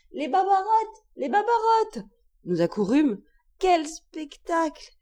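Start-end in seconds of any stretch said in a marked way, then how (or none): 0.1-2.1 cry of distress. A narrator's reading. Old servant finds vermin in her kitchen, cry of distress. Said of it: "poussa un cri de détresse". 3.6-4.9 high, slow exclamation; aghast exclamation. A narrator's reading. Narrator discovers the infested kitchen, aghast.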